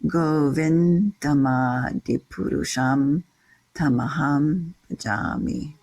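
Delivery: measured "Govindamadi purusham" with long syllables held; metrical Sanskrit recitation. Shown as a demonstration. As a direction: chant "Govindamadi purusham" to the meter, in separated phrases. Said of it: "how it falls into this meter".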